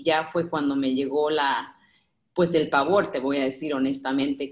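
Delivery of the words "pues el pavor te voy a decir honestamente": normal talking voice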